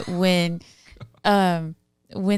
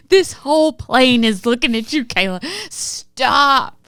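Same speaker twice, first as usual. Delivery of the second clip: higher, louder mock-mortified protest; mimicked exasperated plea; 6 semitones higher, 6 dB louder than usual. Wife acting out husband pleading on the plane, exasperated. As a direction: imitate a mortified husband begging her to stop.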